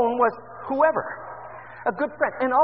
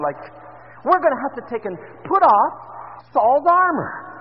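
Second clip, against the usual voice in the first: fervent preaching; 9 dB louder than usual. Acted. Preacher pressing his flock about old bitterness, loud and fervent. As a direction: drive the challenge home to the congregation.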